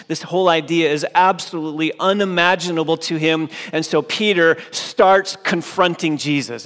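loud, ringing pulpit voice building Peter's outrage; fervent preaching. Acted. Preacher voicing Peter's outrage to the congregation, loud. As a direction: drive it home from the pulpit.

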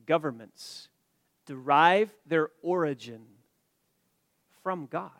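"Governments ... derive their origin ... from God" slowly, with long pauses; slow, spaced-out restatement that lets each part sink in; deliberate repetition. Real, not acted. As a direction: break it into weighty phrases, pausing before "from God". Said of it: "Let me say that again".

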